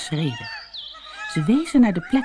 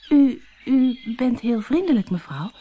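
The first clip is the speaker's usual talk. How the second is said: put-on higher girl's voice, stumbling over the words; stammering girl; 7 semitones higher than usual. A narrator's reading. Doortje stammers to the witch she thinks she is not. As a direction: stammer it out, unsure and a little scared. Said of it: "stamelde ze".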